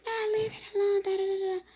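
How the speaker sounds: very high mock shriek; high-pitched frantic protest, trailing into da-da; imitated screaming. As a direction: mimic her screaming, high without full volume. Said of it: "the girl starts screaming".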